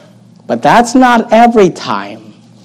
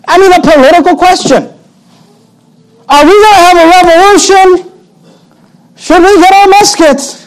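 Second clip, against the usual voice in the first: high, eager revolutionary voice; mocking the disciples as eager rebels wanting muskets; mock excitement; 11 semitones higher than usual. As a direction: play the eager rebel, pitched way up. Mocking disciples' political zeal.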